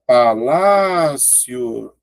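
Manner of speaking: "palácio" drawn out slowly, syllable by syllable; slow teacher's breakdown of "palácio". Shown as a demonstration. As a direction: stretch each syllable of "palácio", keeping "cio" as one glide. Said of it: "é uma paroxítona terminada de tongo".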